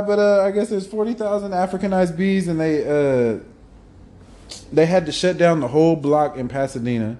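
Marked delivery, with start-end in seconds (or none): none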